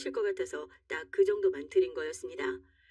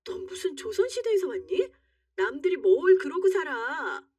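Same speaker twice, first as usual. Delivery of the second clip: much higher, scornful outburst; incredulous anger; 8 semitones higher, 3 dB louder than usual. Acted. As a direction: fire it back high and indignant. Wife mocks husband in quarrel.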